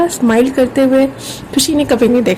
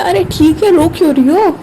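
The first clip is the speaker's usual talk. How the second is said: higher-pitched, mildly startled reassurance; concerned reassurance; 5 semitones higher than usual. Acted. Woman reassuring her tearful friend on a call, mildly startled. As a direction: hurry to calm someone who seems tearful.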